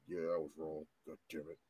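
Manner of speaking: a mock grudging apology, quiet and low; through gritted teeth. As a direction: mimic the girlfriend forcing out the words through her teeth. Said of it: "bite her teeth like".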